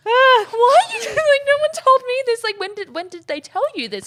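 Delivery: shrill panic at the band; high, loud flustered wail; panicking planner caught off guard; panicked high squeal. Retold or imitated. Panicked quote of herself.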